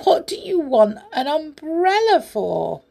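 high, loud, amused questioning; giggly teasing. A narrator's reading. Amelia Jane teases teddy about his umbrella, giggly. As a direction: tease with incredulous high pitch. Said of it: "said Amelia Jane with a giggle".

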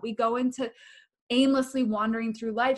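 ordinary talk, nothing marked

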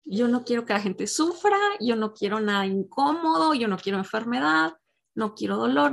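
ordinary talk, nothing marked

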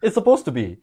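excited yes. Retold or imitated. Gleeful reply to friend.

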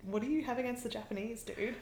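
soft, quick grumble under the breath; muted aside. Retold or imitated. Sulu to the Eurocentric alien, quietly put out. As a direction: mimic a sidelined guest's quiet objection. Sulu's sidelined aside, mimicked.

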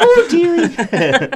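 very high, drawn-out nanny exclamation; loud mock-nanny cry through a laugh; Missus Doubtfire. Teasing a fan about speaking like Missus Doubtfire, playful nanny impression. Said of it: "speaking like Missus Doubtfire or something".

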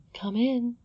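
high, light invitation; princess's voice. Acted. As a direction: call gently from inside in a high voice.

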